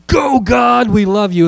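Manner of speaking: high, rousing rallying shout; loud cheer. Shown as a demonstration. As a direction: lead the crowd in a fan's cheer. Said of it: "as loud as we can".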